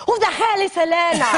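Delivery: sudden high demanding outburst, fast; mock angry demand. Wife confronting husband at dinner about the message, mock angry. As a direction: burst out with a hammed-up accusation.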